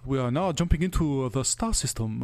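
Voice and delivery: mock Japanese accent.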